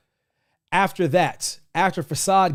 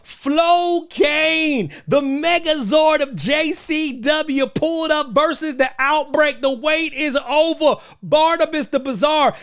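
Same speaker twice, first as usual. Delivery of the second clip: ecstatic hype; 10 semitones higher than usual. Real, not acted. Host raving about Stephen Flow to viewers, ecstatic shouting. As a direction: lose it like a fan whose hero arrived. Ecstatic over favorite wrestler.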